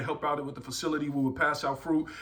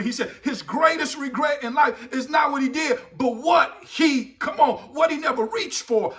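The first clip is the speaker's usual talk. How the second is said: high, driving sermon delivery; impassioned preaching; 7 semitones higher, 6 dB louder than usual. Real, not acted. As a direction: press it on the congregation with rising urgency. Preaches at full force.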